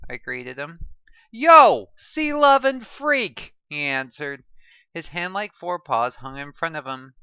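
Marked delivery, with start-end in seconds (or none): 1.3-3.5 high, loud, slow teasing; hammed-up playful jab; teasing shout. A narrator's reading. Character's loud teasing greeting.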